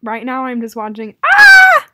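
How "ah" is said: high, loud sudden shriek of joy; excited shriek. Real, not acted. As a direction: shriek with glee. She gets into the game.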